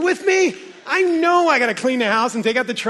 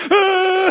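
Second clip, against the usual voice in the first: OCD shriek; 9 semitones higher, 7 dB louder than usual. Retold or imitated.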